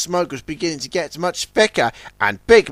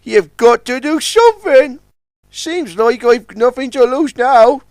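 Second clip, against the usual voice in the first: high, louder pleading; desperate young tug; 9 semitones higher, 5 dB louder than usual. Acted. Trapped Sunshine pleads with the tugs, high. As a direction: beg out of desperation. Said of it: "feeling desperate".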